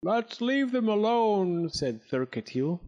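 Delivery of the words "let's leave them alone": fearful cotter. A narrator's reading. Thurketiel, fearful, voiced differently.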